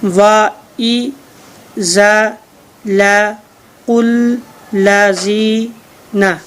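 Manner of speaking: वा ई drawn out very slowly, then जा ला कुल ला जी ना one at a time; slow drawn syllables. Shown as a demonstration. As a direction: stretch each syllable like a teacher modelling. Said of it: "देखें जी कितनी आसानी से मैंने आपको बताया".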